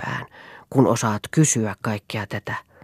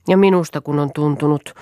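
vaguely; 3 semitones higher than usual. A narrator's reading. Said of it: "puhui Rolf epämääräisesti eteensä tuijottaen".

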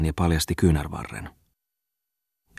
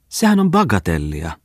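higher-pitched, vexed wife's voice; annoyed exclamation; 4 semitones higher, 3 dB louder than usual. A narrator's reading. Wife brushing off the matter to her husband, annoyed. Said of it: "huudahti rouva harmistuneella äänellä".

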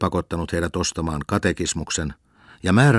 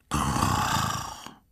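deep rumbling snore; a sleeping man's big snore; loud snore; 4 semitones lower, 7 dB quieter than usual. Acted. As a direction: snore like thunder. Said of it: "kuului silloin mahtava jyrinä".

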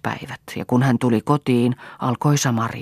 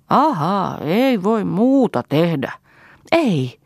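high, drawn-out mocking echo; scornful retort; 6 semitones higher, much slower than usual. A narrator's reading. Mother echoing daughter's excuse in a quarrel, scornfully. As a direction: throw it back slowly and sarcastically.

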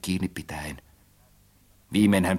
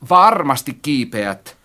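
loud, higher, drawn-out insistence; insisting; 4 semitones higher, 6 dB louder, slower than usual. A narrator's reading. Narrator insists reluctant friend climb, forcefully. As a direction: overrule the refusal firmly. Acted quarrel over climbing.

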